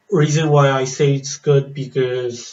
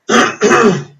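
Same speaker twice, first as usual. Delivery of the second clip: loud, sharp throat clear; 10 semitones higher, 8 dB louder than usual. Real, not acted. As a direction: clear the throat hard before going on. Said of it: "Sorry, my voice is getting raspy".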